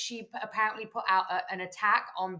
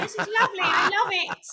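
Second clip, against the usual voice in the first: mock gushing; 12 semitones higher, 7 dB louder than usual. Gushing over a Christmas movie.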